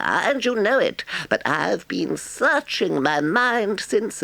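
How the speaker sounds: high, airy Toad voice, unrepentant; cheeky, unrepentant Toad; breezy Toad. A narrator's reading. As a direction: flatter the badger, then shrug it off. Toad's defiant speech.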